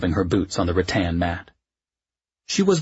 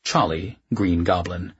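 usual speaking voice

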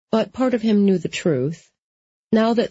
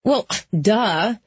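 higher-pitched dismissive scoff; 4 semitones higher than usual. A narrator's reading. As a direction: scoff as if it's obvious. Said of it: "Molly scoffed".

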